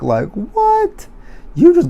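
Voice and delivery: squeaky high like, what; high squeak of disbelief. Real, not acted. Viewer squeaking in disbelief at the house bombing.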